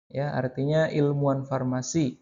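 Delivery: ordinary talk, nothing marked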